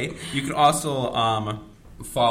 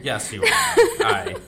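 laughing; 6 dB louder, much slower than usual. Real, not acted. High laughing agreement.

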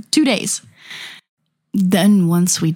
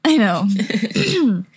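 ordinary delivery

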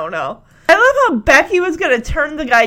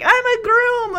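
higher, indignant echo; indignant groom; 11 semitones higher than usual. Retold or imitated. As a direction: shout it back proudly. [name] echoing.

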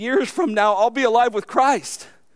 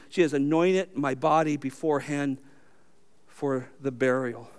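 scripture reading; 4 semitones lower than usual. Acted. Pastor reading the gospel to his church, hushed reading.

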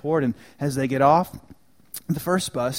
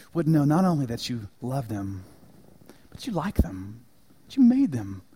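closing prayer; 4 dB quieter than usual. Acted. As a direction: pray over the church gently. Pastor leads closing prayer.

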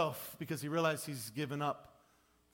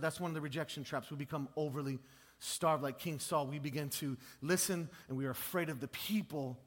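ordinary delivery

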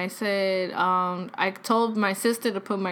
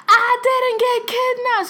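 high, slow yell into the phone; drawn-out, high-pitched imitated scream; re-enacted screaming; 13 semitones higher, 4 dB louder, slower than usual. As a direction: holler it slowly for a hard-of-hearing grandfather. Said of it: "I'm screaming on the phone".

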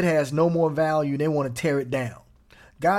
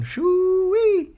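high, drawn-out whistle of wonder; slow, much higher, delighted; shoo-wee exclamation; 5 dB louder than usual. Real, not acted. Preacher reacting to an abused verse, high delighted exclaim.

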